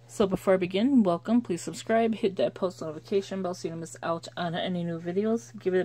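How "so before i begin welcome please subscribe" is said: normal talking voice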